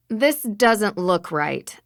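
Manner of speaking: usual speaking voice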